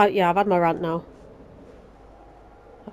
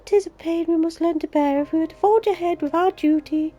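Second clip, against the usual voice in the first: higher, earnest solemn heroine voice; reading Minfilia's lines; 9 semitones higher than usual. Acted. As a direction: voice the grieving leader with noble sincerity, pitched up. Reads the character's dialogue aloud.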